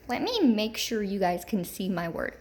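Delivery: normal talking voice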